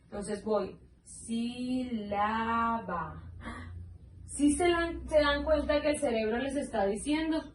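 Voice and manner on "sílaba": stretched out syllable by syllable; slow syllable reading. Shown as a demonstration. Teacher slowly sounding out for pupils. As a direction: say it very slowly, pulling each syllable apart. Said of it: "leyendo la palabra lentamente".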